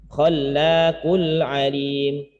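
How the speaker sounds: "Khallaqul alim" with the lam held long, slowly; held double l. Shown as a demonstration. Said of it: "Ini ada dua huruf". (lam bertasydid ditahan)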